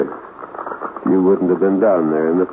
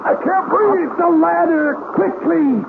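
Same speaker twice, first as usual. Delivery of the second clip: much higher, urgent frantic cries; fleeing smoke, yelling in panic; frantic shouts from a burning house; panicked escape. Acted.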